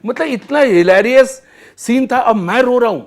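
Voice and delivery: ordinary talk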